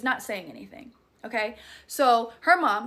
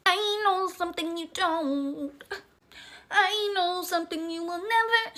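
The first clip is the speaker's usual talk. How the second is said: sing-song ghostly taunt; eerie possessed voice; 7 semitones higher than usual. Acted.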